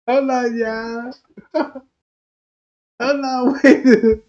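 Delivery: real laughing fit between words; cracking up. Real, not acted. Comedian losing it at the failed female voice, laughing.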